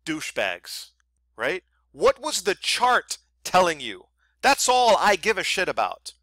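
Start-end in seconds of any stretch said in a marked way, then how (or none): 1.9-6.1 raised, louder, exasperated; fed up with the hype, let it rip; real irritation pushing the voice up and louder; annoyed rant. Real, not acted. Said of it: "Why do I sound like I'm so angry right now?".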